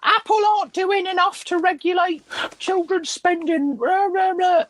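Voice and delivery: high whiny mocking voice; squeaky parody of a complaining parent; mocking whiny complainer voice.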